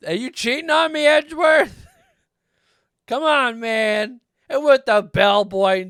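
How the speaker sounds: high, loud, hammed-up betrayed lover; loud, high mock jealousy with a laugh behind it; mock jealous. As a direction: play the jilted partner for laughs. Jokingly accusing game character.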